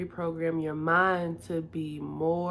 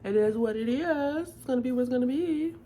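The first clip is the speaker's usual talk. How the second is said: mock indifference; 7 semitones higher, 4 dB louder, faster than usual. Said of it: "I don't care".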